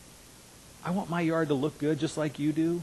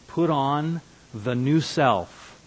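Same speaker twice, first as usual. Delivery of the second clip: each word laid down slowly; drawn-out self-reminder; slow daily affirmation; 3 dB louder, much slower than usual. Shown as a demonstration. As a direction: say it slowly like a morning mantra. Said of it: "how that works just kind of verbally".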